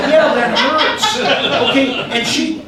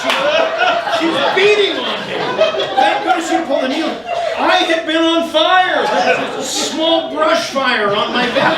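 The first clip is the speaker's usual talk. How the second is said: raised, animated panic; excited; 7 semitones higher than usual. Real, not acted. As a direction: tell it breathless with alarm. Panic on fire retold.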